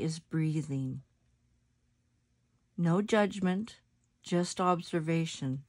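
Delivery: soft, unhurried meditation voice with long pauses; guided meditation. Acted. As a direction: guide them gently into their breath, letting phrases settle softly.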